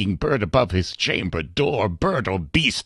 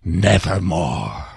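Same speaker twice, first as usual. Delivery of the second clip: raven's reply; 4 semitones lower, slower than usual. Acted. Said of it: "Quoth the Raven".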